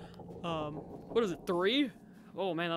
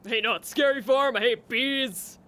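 loud protest; 2 semitones higher, 8 dB louder, slower than usual. Real, not acted. Bees buzzing again, player protests.

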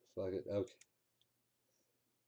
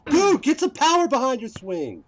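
high, loud scolding yell; frustrated shout; 12 semitones higher, 9 dB louder than usual. Real, not acted. Gamer scolding his character's weak swing. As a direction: bark at him to swing harder.

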